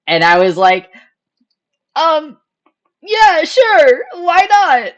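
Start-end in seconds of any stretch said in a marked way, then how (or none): 1.9-4.9 much higher and louder, a squeaky fake-casual yes; squeaky nervous agreement. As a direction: squeak it out, pretending to be cool. Retelling her nervous reply.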